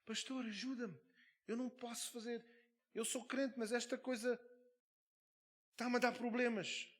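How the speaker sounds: high, pleading plea for help; other pleading voice. Retold or imitated. Possessed man begs the pastor for help in a high pleading voice. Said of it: "ele falou com outra voz".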